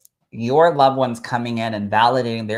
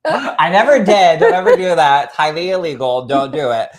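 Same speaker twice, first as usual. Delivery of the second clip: laughing disclaimer; 5 semitones higher, 9 dB louder than usual. Real, not acted. Host warning listeners off bomb jokes, loud and laughing. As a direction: laugh while warning them off.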